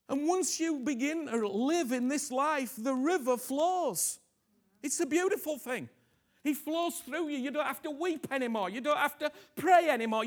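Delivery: high, loud, ecstatic; loud high rapturous sermon; fervent preaching. Real, not acted. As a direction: soar in praise.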